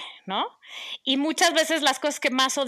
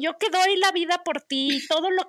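gasping soap opera drama; 3 semitones higher than usual. Retold or imitated. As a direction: play the wounded martyr mother. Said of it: "casi como de telenovela". (drama de telenovela indignado)

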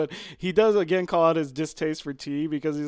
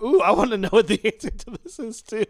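excited; 5 semitones higher, 5 dB louder than usual. Retold or imitated. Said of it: "I'm just like".

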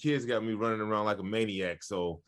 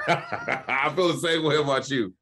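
laughing through the words; 4 semitones higher, 4 dB louder than usual. Real, not acted. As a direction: chuckle it back warmly. Laughing reply to compliment.

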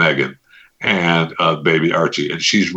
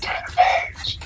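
mimicking whisper; 6 dB quieter, slower than usual. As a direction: whisper it mockingly.

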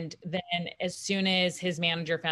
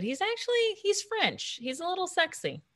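much higher, breathy gushing; flirty gushing; 12 semitones higher than usual. Real, not acted. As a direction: swoon over him. Gushing about his sex appeal.

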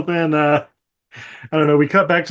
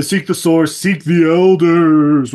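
loud, drawn-out mock intoning; hammed-up prophecy voice; 4 dB louder, slower than usual. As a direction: build to a slow, stretched proclamation. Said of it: "super deep voice".

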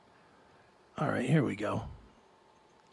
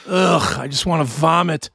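normal talking voice

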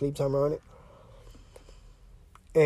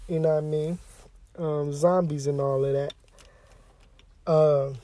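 usual speaking voice

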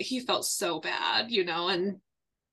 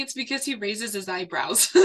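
normal talking voice

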